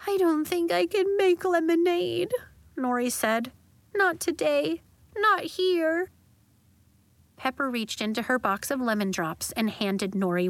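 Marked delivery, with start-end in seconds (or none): 0.1-2.3 high, tearful voice after crying; girl worn out from crying; cried out. Acted. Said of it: "when Nori was all cried out". 3.9-6.1 high, tearful refusal; girl cried out refusing comfort; teary. Acted. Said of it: "when Nori was all cried out".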